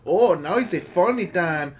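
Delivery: drawn-out mock Indian accent; bad Indian accent. Retelling the movie gag to a co-host in a bad Indian accent. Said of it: "a bad Indian accent".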